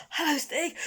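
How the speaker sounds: put-on a high, breathless boy's greeting; panting. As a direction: greet him while still out of breath. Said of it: "panted Barney".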